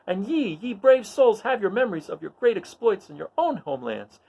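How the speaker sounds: high, ringing battle oration; Luke's rallying speech. Acted. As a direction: rouse the troops like a stage king. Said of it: "Luke offers some encouraging words".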